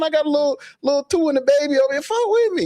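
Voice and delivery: very high mock street hustler call. Podcaster imitating a street hustler, very high. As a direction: ham up a hustler begging to be put on. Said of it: "First thing you say is".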